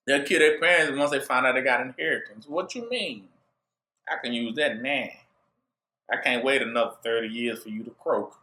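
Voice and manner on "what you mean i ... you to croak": put-on lower, softer greedy kid muttering. Low, soft greedy kid voice.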